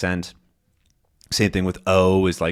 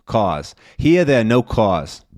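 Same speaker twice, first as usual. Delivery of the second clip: practising a New York "cars", R-less; New York accent; 2 semitones higher, 4 dB louder than usual. Shown as a demonstration. Teacher retrying the practice phrase, New York accent. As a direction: drop the Rs New York style, rounded vowel in cars.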